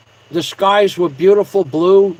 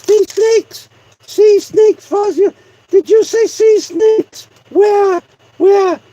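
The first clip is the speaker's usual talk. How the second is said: very high, loud squeaky puppet voice; 4 dB louder, slower than usual. Pretend. Mister Obama asked about sea snakes, squeaky high excited voice. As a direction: squeak like a startled little puppet.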